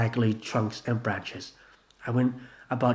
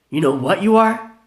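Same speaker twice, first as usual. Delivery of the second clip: loud high taunt; angry challenge; 8 semitones higher, 9 dB louder than usual. Acted. Gina taunting Vanilla, loud challenge. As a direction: square up. Quarrel insult.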